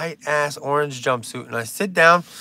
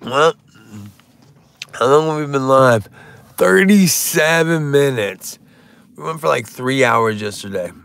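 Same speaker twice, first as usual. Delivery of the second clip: normal talking voice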